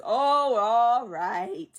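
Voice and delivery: loud, drawn-out exasperated concession; grudging surrender. Retold or imitated. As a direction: give in with a big, heaved-out groan. Ancestor grudgingly gives in to Mushu.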